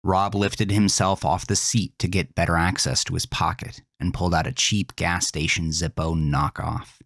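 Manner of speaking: ordinary delivery, nothing marked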